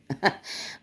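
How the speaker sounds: a quiet airless chuckle; soft breathy laugh. Real, not acted. Teasing viewers about her ex, soft breathy laugh. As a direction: let a little laugh slip out.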